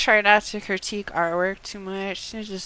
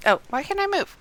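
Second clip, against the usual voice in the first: high, baffled cry of frustration; suddenly find you can't move; frustrated surprise; 11 semitones higher, faster than usual. Real, not acted. Character stuck in the game.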